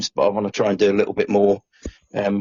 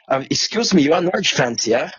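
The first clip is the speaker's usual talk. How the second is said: higher-pitched mock German accent; dreadful German accent; 5 semitones higher than usual. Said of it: "excuse the dreadful German accent".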